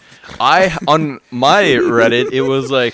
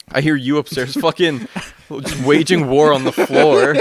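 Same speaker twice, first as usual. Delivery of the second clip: normal talking voice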